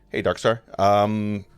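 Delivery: ordinary talk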